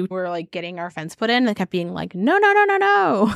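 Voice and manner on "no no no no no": mock neighbor protest. Imitating the upset neighbor who objects to the new fence, in a high mock voice. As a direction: squeal no no no like an outraged neighbor.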